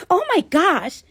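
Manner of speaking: put-on high-pitched Staten Island girl gasping; Staten Island accent, startled and pitched high.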